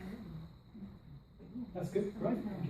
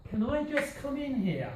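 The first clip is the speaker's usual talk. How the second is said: rising to a peak on I, falling at the end; intonation model; 6 semitones higher than usual. Shown as a demonstration. Teacher modelling the phrase's tune for listening. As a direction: make the pitch peak on "I". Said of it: "try and listen for the highest pitch".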